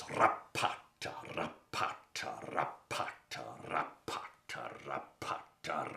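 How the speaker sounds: slow whispered rapata, rapata; lion's padding sound. Shown as a demonstration. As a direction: whisper the lion's footsteps slowly, fading. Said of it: "the sound he makes is this".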